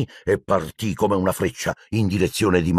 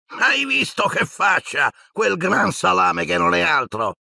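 much higher, slow jeering brat voice; sneering mockery; 10 semitones higher, slower than usual. Malfoy jeers at Neville's face, high and sneering. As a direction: taunt the fallen boy with glee.

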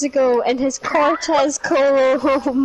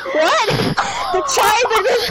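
sharp high what; real surprise; incredulous what; 9 semitones higher than usual. Real, not acted. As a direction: disbelief at the twist. Incredulous at the tribe twist.

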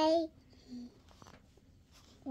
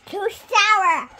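louder, dragged-out whine; loud slow complaint; 3 semitones higher, 10 dB louder than usual. Real, not acted. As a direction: protest it loudly and slowly. Child complaining about sour candy.

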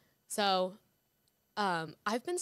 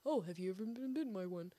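lightly put-on voice, softer; mock impression; 8 dB quieter than usual. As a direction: mimic your co-host with a put-on voice. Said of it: "Or whatever you sound like".

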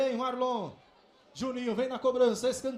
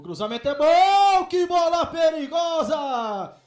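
excited shout; 8 dB louder than usual. Real, not acted. Dangerous cross in the box.

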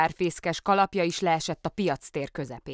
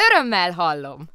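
cheerful call; 2 semitones higher, 9 dB louder than usual. A narrator's reading. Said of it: "kiáltotta vidáman".

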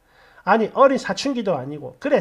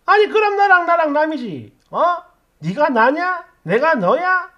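higher-pitched, indignant mother firing back; acted quarrel, pitch jumping up in irritation; exasperated retort; 6 semitones higher, 4 dB louder than usual. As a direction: throw sharp rhetorical questions at your child.

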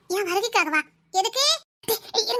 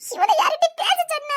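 annoyed scolding; 7 semitones higher, 4 dB louder than usual. Acted. Friend scolds blabbering accomplice.

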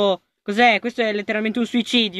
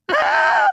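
piercing high scream; real panicked scream; terrified scream; 3 dB louder than usual. Real, not acted. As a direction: shriek at the jumpscare. Chased again in game.

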